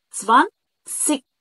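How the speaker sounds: "سفانسيك" slow and loud, split, hard final k; clicked K ending. Shown as a demonstration. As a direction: break "سفانسيك" in two, snap the k. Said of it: "تكة الأمان".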